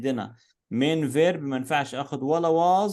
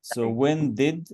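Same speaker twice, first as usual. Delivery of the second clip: slow model question; slower than usual. Shown as a demonstration. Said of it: "Bo-, Bora".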